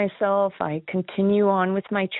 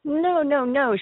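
mildly horrified retold denial, higher pitch; 6 semitones higher, slower than usual. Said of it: "she looked horrified".

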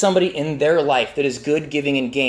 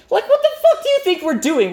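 exasperated retort; 14 semitones higher, 3 dB louder than usual. Retold or imitated. Exasperated at the sheriff's question.

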